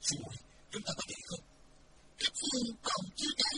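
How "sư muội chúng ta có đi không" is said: soft, hushed question; softly. A narrator's reading. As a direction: ask her gently under the breath. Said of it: "khẽ nói". (khẽ khàng hỏi nhỏ)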